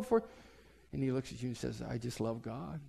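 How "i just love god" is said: mock serene, much softer and lower; serene hush. Date calmly answers mom, soft serene hush. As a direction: breathe it out peacefully.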